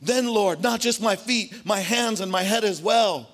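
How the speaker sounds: high, loud overeager plea; eager Peter. Retold or imitated. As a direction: beg for the whole bath, overdoing it. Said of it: "Peter says".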